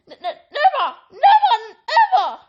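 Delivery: high, loud sobbing wail. A narrator's reading. Elf wails to Harry, high loud sobbing. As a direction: wail it through tears. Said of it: "he wailed".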